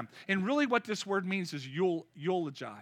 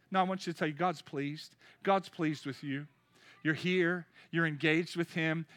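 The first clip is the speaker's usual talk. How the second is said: ordinary talk, nothing marked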